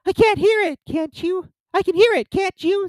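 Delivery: anxious, very high squeaky voice; nervous tiny creature seeking agreement; Piglet. A narrator's reading. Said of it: "said Piglet anxiously".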